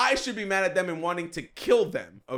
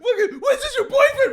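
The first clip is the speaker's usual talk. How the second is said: very high, loud mock screaming; hammed-up screeching rant from the background; imitated yelling; 7 dB louder than usual. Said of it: "Tim is still yelling".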